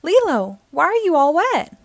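a teacher demanding to know why Lilo is wet; scolding teacher. A narrator's reading. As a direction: scold the soaked girl. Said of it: "yelled the teacher".

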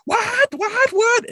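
very high, loud repeated squawks; shocked outrage. Retold or imitated. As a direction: screech it like an outraged mother. Outraged mother over the phone.

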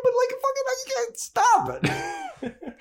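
mock lovesick wail; hammed-up high confession; pleading Spike. Spike confessing love, voiced.

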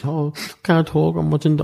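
high, drawn-out upset voice; tearful mum. Retold or imitated. Mum upset watching the funeral, tearful. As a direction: imitate a distraught mum, high and choked.

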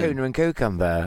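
put-on a light Northampton accent on "Tuna and cucumber" with a broad vowel. Alan mocking the Northampton accent. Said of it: "Is that how they talk in Northampton?".

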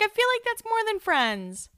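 mock high inner voice. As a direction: raise the voice high as if half-joking. Teasing own inner voice.

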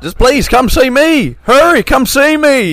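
high, slow, loud hammed-up pitchman plea; pushy car salesman. Mocking a dealer's radio spot, loud pleading pitch. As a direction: beg the listener to come in like a desperate dealer.

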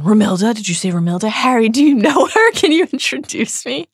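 rising, high-pitched eager questions; lovestruck eagerness. A narrator's reading. Love-potioned Ron begging Harry, eager.

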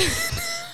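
short high-pitched giggle. Real, not acted. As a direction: burst into a squeaky laugh. Laughs at her own order.

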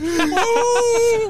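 very high drawn-out "ooh"; hammed-up high wail of fright; panicked wail. Passenger wailing during the turn.

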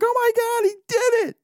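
very high-pitched, excited mock yell; yelling wife. Wife cheering the home run, imitated high excited yell. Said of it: "My wife's even yelling".

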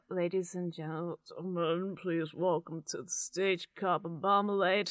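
put-on MC voice, unhurried; convincing announcer voice. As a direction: introduce the band like a PA announcer. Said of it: "a very convincing announcer voice".

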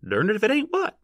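high rustic question, sharply rising on the end; demanding. A narrator's reading. Man at the rear who cannot see, demanding. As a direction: demand an answer, pitched high. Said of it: "demanded a voice from the rear".

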